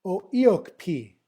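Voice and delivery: "O Iokpi" slowly, as oh ee-OHK-pee; "O Iokpi" drawn out, aspirated P at the end; pronunciation demo. Shown as a demonstration. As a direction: model the whole of "O Iokpi" unhurriedly. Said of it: "the rest of it".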